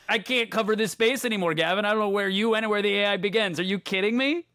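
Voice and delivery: high-pitched comic disbelief; mock exasperation. Co-host reacting to the Yoda bit, mock-exasperated.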